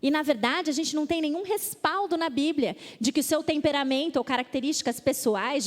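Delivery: normal talking voice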